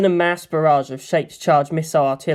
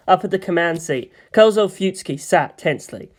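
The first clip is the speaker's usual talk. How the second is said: usual speaking voice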